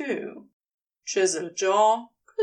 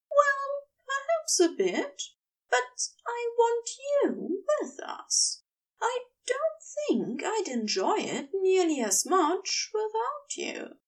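higher, tender wife's voice; adoring wife; 10 semitones higher than usual. A narrator's reading. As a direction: play the wife coaxing her husband sweetly. Wife's dialogue, read aloud.

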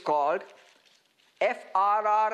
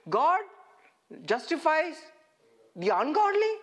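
mock disbelief; 5 semitones higher than usual. Feigned disbelief at the verse.

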